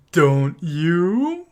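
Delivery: loud high hammed-up tease; mock seductive witch. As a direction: throw the line back mockingly. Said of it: "You just said".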